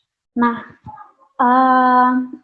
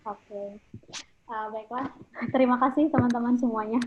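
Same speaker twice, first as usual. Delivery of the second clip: much softer, faint and distant; barely audible; 10 dB quieter than usual. Real, not acted. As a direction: talk faintly, off the mic. Sound check, mic too quiet.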